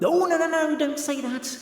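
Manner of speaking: high, urgent pleading to stop; alarmed protest. Acted. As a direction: cut in high, trying to hush them. Urgently stops the listener speaking.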